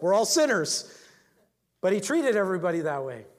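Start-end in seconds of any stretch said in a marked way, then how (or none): 0.0-1.0 punchy, raised preacher's outburst; loud exclamation. Real, not acted. Pastor joking to his congregation, loud exclamation. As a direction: burst out louder after the laugh.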